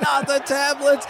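high, slow crowd wail. Retold or imitated. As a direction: wail like the whole arena. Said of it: "the whole crowd is like".

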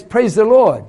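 loud, high praise the Lord. Retold or imitated. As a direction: call it out with joy. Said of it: "when somebody goes".

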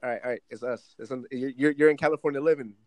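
ordinary delivery

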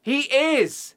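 high, emphatic comeback; insistent contradiction. Real, not acted. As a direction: push back hard, pitch jumping up. Contradicting co-host in quiz argument.

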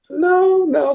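high, incredulous retold no; squeaky comic refusal; mock denial. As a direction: pitch way up, playing at disbelief. Retelling reaction to her naming films.

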